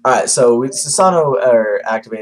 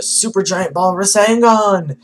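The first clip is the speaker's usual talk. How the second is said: high drawn-out attack cry; attack shout; 9 semitones higher, slower than usual. Retold or imitated. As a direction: yell the move name slowly, high. Naruto's attack called out.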